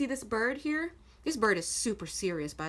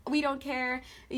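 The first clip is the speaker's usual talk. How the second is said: ordinary delivery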